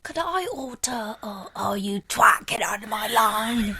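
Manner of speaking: loud, slow, laughing rude order-taker; mock rude cashier. Friend hams up a rude cashier yelling at a customer. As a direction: yell at the customer through laughter.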